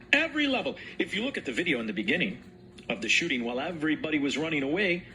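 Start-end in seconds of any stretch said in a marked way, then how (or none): 0.1-0.8 Every said higher and pressed; emphatic echo of Every level; stress on Every. Shown as a demonstration. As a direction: repeat Every level with a punch on Every.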